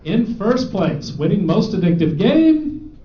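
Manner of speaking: slow dramatic winner reveal; award announcer. Acted. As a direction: draw out the winner's name.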